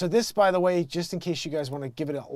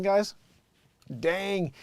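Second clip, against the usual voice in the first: usual speaking voice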